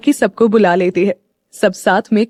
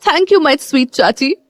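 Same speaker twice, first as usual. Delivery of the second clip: slow, higher, sugary thank-you; sweet thanks; 5 semitones higher, much slower than usual. A narrator's reading. Meera thanking her aunt after a cheek kiss, sweetly. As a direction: drag it out sweetly like a hug.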